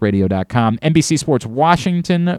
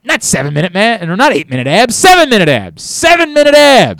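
rising, higher hammed-up movie-line delivery; movie quote; 8 semitones higher, 4 dB louder than usual. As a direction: climb to a triumphant shout. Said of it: "I quoted that yesterday".

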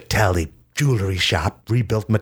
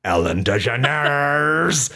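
dramatic counter; 7 semitones higher, slower than usual. Pretend. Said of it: "she countered".

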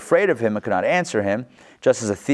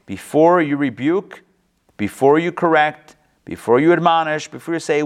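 louder, pressing each before; emphatic urging; 4 semitones higher, 5 dB louder than usual. Real, not acted. Teacher urging students before they rebuke, louder. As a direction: drive home every before you.

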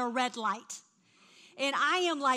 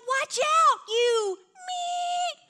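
much higher, louder bratty shout; mock teen girl yell; 7 dB louder, much slower than usual. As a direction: yell it like a rude teen cutting you off. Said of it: "She's like".

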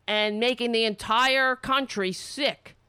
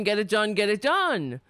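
normal talking voice

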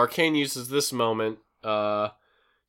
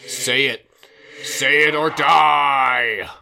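ghostly surveyor; 3 semitones higher, 10 dB louder than usual. Retold or imitated. Carter's dead victim haunting him, loud ghostly threat. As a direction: boom it out like a vengeful ghost. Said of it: "We hear a voiceover of the surveyor say".

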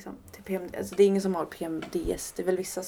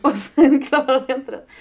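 laughing it out, higher and louder; giggling at her own failure; 5 semitones higher, 13 dB louder than usual. Real, not acted. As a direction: laugh through the admission.

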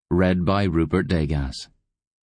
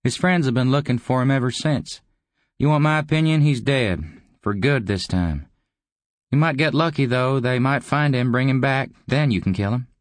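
high, animated character voice; higher, breezy schemer; goading visitor; 8 semitones higher than usual. A narrator's reading. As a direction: goad the old man in a higher voice.

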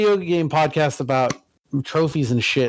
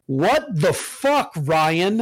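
slow, pitched-up co-host mimicry; Chris impression; 3 semitones higher, much slower than usual. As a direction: stretch it out in exasperated disbelief. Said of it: "my impression of Chris".

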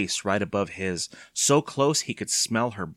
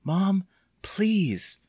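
put-on high, drawn-out child's plea; pleading; 10 semitones higher than usual. A narrator's reading. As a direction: beg Mom to step in. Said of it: "he pleaded".

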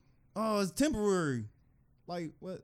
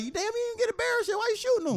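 much higher, incredulous mock voice; mock disbelief. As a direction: play it baffled and high.

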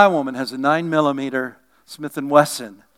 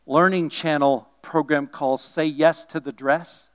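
normal talking voice

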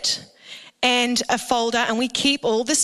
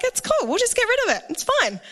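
breezy brush-off; 10 semitones higher, faster than usual. Retold or imitated. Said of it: "and I was like".

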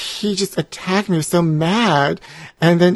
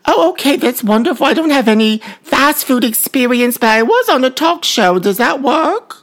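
higher, louder put-on voice; high-voiced job seeker; 9 semitones higher, 5 dB louder than usual. Ex-talk-show guest asking a fast food worker for a job, high put-on voice.

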